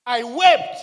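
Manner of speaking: sudden loud preacher's outcry; loud cry out. Retold or imitated. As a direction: burst out loud on the words. Preacher retelling his own weeping.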